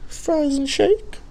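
very high, slow cartoonish "Fries and shake"; high squeaky bit. Shown as a demonstration. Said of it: "Fries and a shake".

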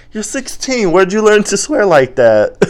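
very high, loud, incredulous squeal; mock shocked protest. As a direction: imitate an appalled boyfriend, voice shooting up. Acts shocked boyfriend scolding her.

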